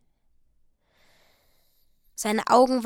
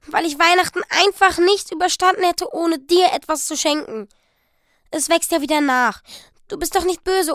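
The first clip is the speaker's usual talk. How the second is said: louder and higher, rushing anxious plea; pleading excitedly; 5 semitones higher, 8 dB louder than usual. Acted. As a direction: talk fast to win him over. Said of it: "rief sie". (aufgeregt flehend, laut und hoch)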